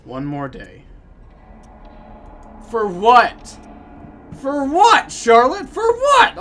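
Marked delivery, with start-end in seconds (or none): 2.7-6.4 each repeat higher and louder; rising demand. Pretend. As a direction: press her harder every time. Escalating demand to Charlotte.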